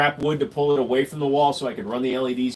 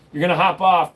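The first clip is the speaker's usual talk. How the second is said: ordinary talk, nothing marked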